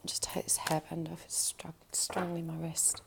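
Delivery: half-whispered to herself; quick whispered aside off-mic. Real, not acted. Muttered aside removing hairband.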